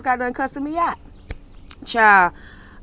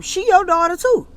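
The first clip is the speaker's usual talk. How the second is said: higher, louder outraged "too"; indignant exclaim; 10 semitones higher, 5 dB louder than usual. Real, not acted. Host reacting to the mother's remark, indignant exclaim. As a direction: call her out, incredulous.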